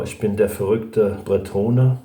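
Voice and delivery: ordinary talk, nothing marked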